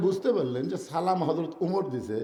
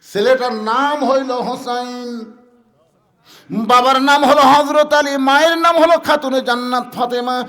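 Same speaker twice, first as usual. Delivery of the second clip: loud, high chanted sermon lines; loud rhythmic waz chant; full-force preaching; 8 semitones higher, 11 dB louder than usual. Acted. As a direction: preach it out to the crowd, drawing each name long.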